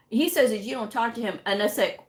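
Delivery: normal talking voice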